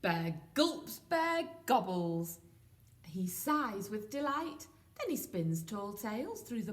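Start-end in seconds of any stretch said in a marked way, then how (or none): none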